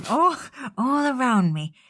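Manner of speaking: spoken solemn character voice, a little high; Sean Connery. Acted. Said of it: "Just do the fucking Sean Connery one".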